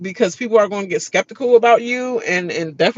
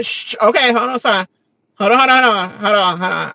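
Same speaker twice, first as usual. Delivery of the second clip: a touch louder, impatient hushing; shushing son; 3 semitones higher, 5 dB louder than usual. Retold or imitated. Mom hushing her son in the car, impatient. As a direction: brush him off while watching. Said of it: "mom shushing son".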